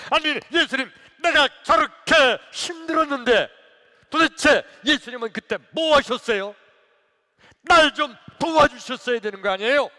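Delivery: loud, high acted outburst; defiant confrontation. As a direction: demand an answer from Jesus at full voice. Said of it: "예수님께 대들었어요". (대들듯 크고 높게 따지며)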